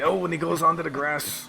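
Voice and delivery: sudden high, loud exclamation; excited. Real, not acted. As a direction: shout it out as the car spins off. Car runs off onto grass.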